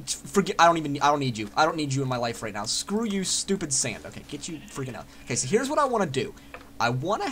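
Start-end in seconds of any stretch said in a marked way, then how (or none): none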